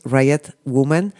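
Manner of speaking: Riot Woman said in English, "RAI-et WU-man"; English pronunciation. Shown as a demonstration. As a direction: slowly give Riot Woman its English sounds. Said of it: "en inglés". (Riot Woman en inglés, despacio, "ráiet wúman")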